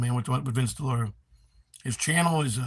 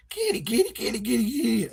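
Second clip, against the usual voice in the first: high sing-song kitty call; calling the cat; 9 semitones higher than usual. Shown as a demonstration. Streamer calls his cat in a high voice. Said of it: "I can't even do that right".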